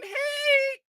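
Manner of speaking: high, drawn-out mimicked "hey"; friendly greeting. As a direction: imitate a cheery wave-by hello. Mimics official waving hello.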